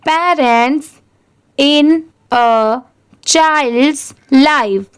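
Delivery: word by word with long gaps; slow, separated words for learners to echo; repeat-after-me reading. Shown as a demonstration. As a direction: model each word, then pause. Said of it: "अब आप बोलिए".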